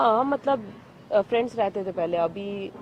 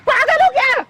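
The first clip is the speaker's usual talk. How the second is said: angry shout; 14 semitones higher, 7 dB louder than usual. Real, not acted. Woman snaps at man who said hello, angry shout. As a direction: yell it high, loud and indignant. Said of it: "चिल्ला क्यों रही हो?".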